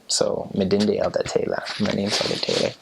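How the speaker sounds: "Midinde Elda Taylor" in a light Ghanaian accent; light Ghanaian accent with the R dropped from Elder. Shown as a demonstration. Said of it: "say it sort of in their accent".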